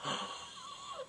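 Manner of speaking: long, soft high gasp; drawn-in breath of mock horror; stunned, theatrical gasp; shocked gasp. Shocked reaction to retold revelation.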